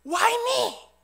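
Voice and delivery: loud, high protesting shout; resentful cry to heaven. Acted. Shipwrecked Viking crying out to heaven, resentful shout. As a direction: shout it upward as a complaint to God. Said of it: "하나님을 원망하면서 하늘 향해 외칩니다".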